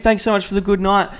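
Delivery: high-pitched grovelling thanks; mock sucking up. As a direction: gush like an eager youngster to a bouncer. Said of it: "sucking up to them".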